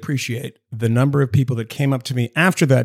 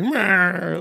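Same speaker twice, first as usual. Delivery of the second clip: drawn-out nasal-style Cagney squawk, pitched up; mock gangster "wah"; 5 semitones higher than usual. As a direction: squawk a cartoon tough-guy "wah". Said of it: "that's that accent".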